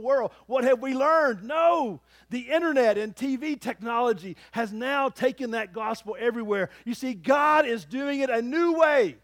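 loud, high preaching from the pulpit; full-force preaching. Acted. Pastor preaching to his church, at full force. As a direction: preach it out with fire.

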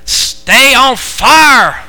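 loud, high, drawn-out Arkansas drawl; Arkansas accent. Shown as a demonstration. Preacher showing how they say it down there, Arkansas accent. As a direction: stretch "fire" into "far", Arkansas style. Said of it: "say it like I moved here from Arkansas".

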